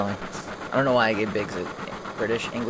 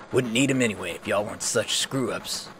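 ordinary talk, nothing marked

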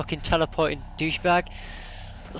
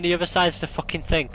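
ordinary talk, nothing marked

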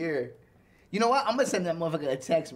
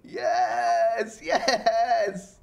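excited; 5 semitones higher, 4 dB louder, much slower than usual. Real, not acted. Cheering an ally's return.

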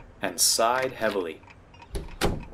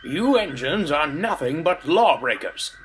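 higher-pitched scolding accusation; snappish constable; 5 semitones higher than usual. A narrator's reading. Constable accusing the engines, indignant and snappish. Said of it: "snapped the constable".

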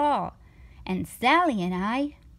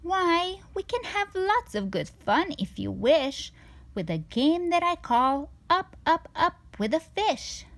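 raised, breezy showman cat; a sly cat brushing off all worry; cheerful cat; 6 semitones higher than usual. Acted. The cat offers a game.

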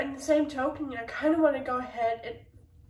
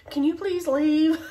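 pleading loudly; 4 semitones higher, 8 dB louder than usual. Retold or imitated. Retold plea to loud neighbours.

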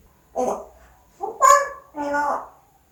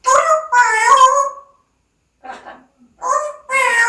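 high teaching voice; 10 semitones higher, 5 dB louder than usual. Shown as a demonstration. Teaching the bird a phrase.

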